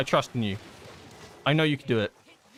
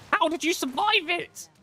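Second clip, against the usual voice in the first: much higher, slow, incredulous; stunned disbelief. Real, not acted. Streamer amazed the tank lived, high slow disbelief. Teammate unexpectedly survives.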